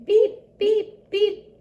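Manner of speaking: high, loud, separate truck beeps; urgent little horn toots; distress beeps. A narrator's reading. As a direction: sound the horn for help. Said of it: "Everybody heard that beep".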